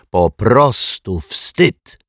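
scornful scolding. A narrator's reading. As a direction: draw out every word in disgust. Accuser scolds the captured teacher. (z pogardą, powoli cedząc słowa)

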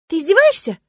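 high, loud outraged question; affronted cry of disbelief; indignant. A narrator's reading. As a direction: burst out in indignation. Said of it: "возмутилась я".

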